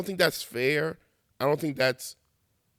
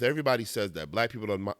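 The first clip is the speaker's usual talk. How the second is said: usual speaking voice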